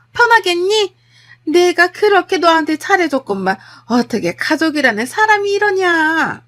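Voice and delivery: high, loud, dramatic reproach; furious mother-in-law. Acted. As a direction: berate her, stretching the final words. 집에 쳐들어와 퍼붓는 시어머니. (격앙되어 퍼붓는 시어머니 말투)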